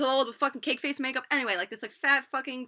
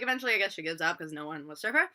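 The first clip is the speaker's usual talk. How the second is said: normal talking voice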